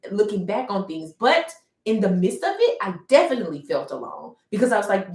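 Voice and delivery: ordinary talk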